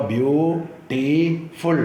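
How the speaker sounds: Beautiful in three parts, ബ്യൂ, T, ഫുൾ, with pauses; split into syllables. Shown as a demonstration. Teacher dividing the word in three, slowly split. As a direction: slowly separate each beat of beautiful. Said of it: "മൂന്നായിട്ട് തിരിക്കാം".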